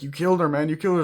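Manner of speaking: lightly acted alarm; acted accusation. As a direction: accuse in mock distress. Acts out the accusation.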